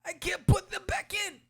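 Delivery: high, loud panicked cries; panicked gutted man. Retold or imitated. Gutted thug panics over his spilled stomach, high and loud. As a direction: freak out in a high yelp. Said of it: "He's like".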